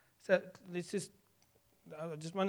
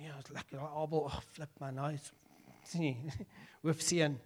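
mumbled sulky grumble; 5 semitones lower, 7 dB quieter than usual. Acted. Cain muttering in anger.

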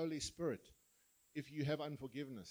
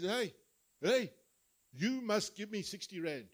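loud, higher-pitched acted demand; 4 semitones higher, 7 dB louder than usual. Forgiven servant accosting his small debtor, loud mock demand. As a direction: call him out, hard and insistent.

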